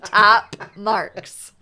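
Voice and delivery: put-on old rating voice, a touch lighter. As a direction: deliver the verdict like a proud judge. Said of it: "Remember my rating scale?".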